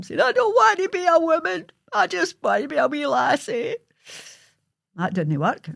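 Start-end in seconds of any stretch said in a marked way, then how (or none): 0.2-3.8 put-on wailing child. Crying child refusing.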